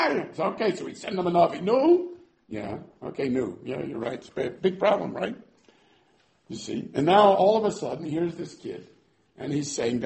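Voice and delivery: ordinary talk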